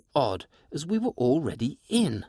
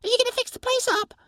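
much higher, fast eager little creature; eager pixie; 3 dB louder, faster than usual. Acted. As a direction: chatter it out quickly like a sprite. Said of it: "the pixie asked".

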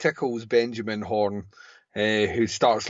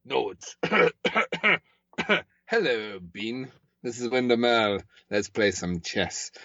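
imitating Wyndham Earl. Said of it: "And he's like that".

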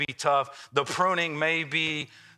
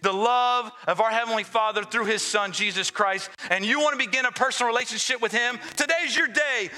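high, driving preacher call; rally the church with rising fervor; booming motivational speech; 6 semitones higher than usual. Acted.

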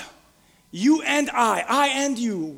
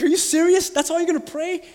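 mock disbelief; 7 semitones higher, 3 dB louder than usual. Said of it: "And he's like".

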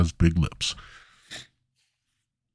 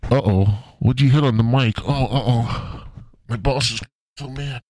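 Harold from Wendy's; 2 semitones higher than usual. Acted. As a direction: babble like a nervous cashier caught on mic. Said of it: "this is Harold from Wendy's".

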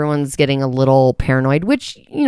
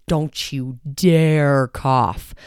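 slow, drawn-out don't you dare cough; slow warning; much slower than usual. Retold or imitated. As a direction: stretch every word like a warning. Warning in the store.

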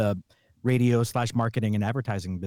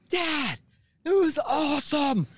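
put-on much higher, bouncy kid voice; excited kid. The excited kid telling his dad, high and thrilled. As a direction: sound thrilled, like a kid buzzing. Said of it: "he's so excited".

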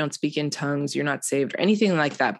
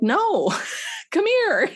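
higher, with a laugh between; lightly voiced pastor, much higher; pastor calling her up; slower than usual. Pastor waving the crying teen forward, higher and laughing.

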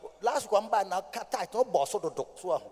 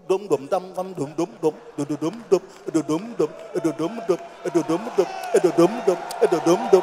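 drum imitation; 2 semitones lower, 6 dB louder than usual. Pastor imitating the church drum, chanted drum beats. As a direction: beat out the drum rhythm with the voice. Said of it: "That's when you hear".

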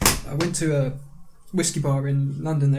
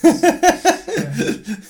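laughing; 5 dB louder than usual. Real, not acted. Teased about escaping his past.

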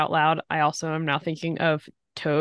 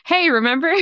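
nagging thought; 8 semitones higher, 5 dB louder than usual. Acted. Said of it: "It's like a".

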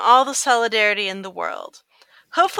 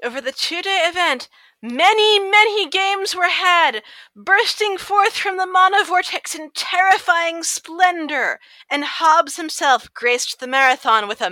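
grand movie trailer voice, higher and slow; epic narrator; 6 semitones higher, 5 dB louder than usual. Acted. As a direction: boom it out like an epic saga.

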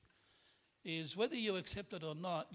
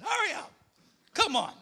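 high, loud hurry-up yell at a dawdling son; impatient father yelling at his son; loud shout; 11 dB louder than usual. Retold or imitated. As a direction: bark it out, much higher and louder. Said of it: "like, loud".

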